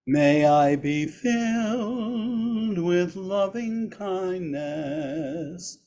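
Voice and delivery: ordinary talk, nothing marked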